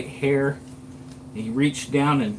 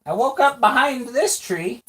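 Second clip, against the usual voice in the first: put-on high squeaky cursed old man voice; 10 semitones higher, 4 dB louder than usual. GM plays Daryl in character.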